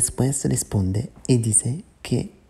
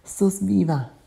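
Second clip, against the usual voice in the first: higher cry at usual volume; understated exclamation, pitched up; mild narrated yell; 7 semitones higher, slower than usual. A narrator's reading. Bia finds her sister alive, mild narrated yell. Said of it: "y Bia grita".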